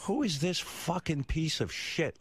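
high, slow, indignant outburst; outraged disbelief. Retold or imitated. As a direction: spit it out, offended and stunned. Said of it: "and I was like".

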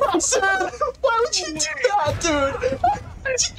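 very high incredulous cry; disbelief. Real, not acted. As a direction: squeal in disbelief. Teammate's fatal mistake.

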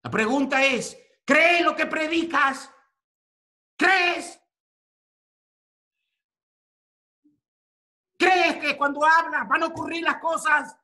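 high, loud, challenging cry; preaching shout. Real, not acted. Preacher demanding of the congregation whether they believe, shouting. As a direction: hammer the question at them.

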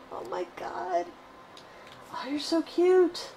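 high, slow adoring coo. Real, not acted. Cooing at the baby duckbill, high adoring baby talk.

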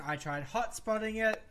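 normal talking voice

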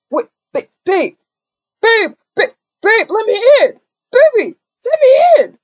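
put-on locked-out boyfriend begging girlfriend at door; desperate yelling. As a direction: yell to be let back in.

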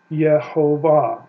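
Yehovah, slow, every syllable apart. Shown as a demonstration. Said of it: "the result of that would be".

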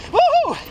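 sharp high startled whoop on a rough patch. Real, not acted. Rider on a loose trail gives a startled whoop. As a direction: yelp it out as the bike slips.

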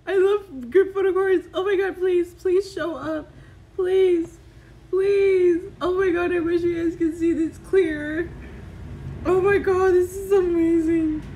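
loud, high gushing; thrilled, begging it to show up; elated. Real, not acted. Fan showing the group card to viewers, elated pleading.

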